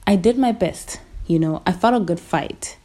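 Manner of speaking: normal talking voice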